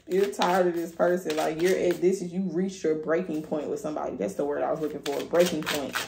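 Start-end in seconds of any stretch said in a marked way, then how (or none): none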